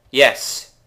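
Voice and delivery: loud happy cheer as it finally works; a burst of real triumph; excited yes. Real, not acted. Gamer cheering when his portal finally lights.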